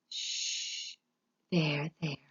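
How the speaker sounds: whispered comfort; soft whispered reassurance; soothing whisper. A narrator's reading. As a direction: hush her like a child. Louise soothing the crying cook.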